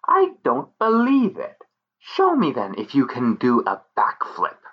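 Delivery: high taunting fairy voice; pixie voice. Acted. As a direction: dare him, sly pixie.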